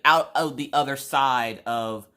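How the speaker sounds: normal talking voice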